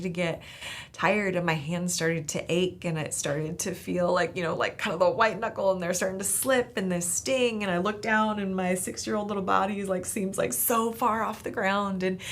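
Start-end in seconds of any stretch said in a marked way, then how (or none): none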